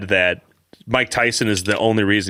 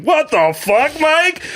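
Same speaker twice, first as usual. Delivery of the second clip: stunned disbelief; slower than usual. Retold or imitated. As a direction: squeak it out in amazement. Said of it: "I was like".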